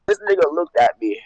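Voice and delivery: talking around a cigarette; cigarette in mouth. Real, not acted. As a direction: cigarette between the lips. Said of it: "let me light this cigarette".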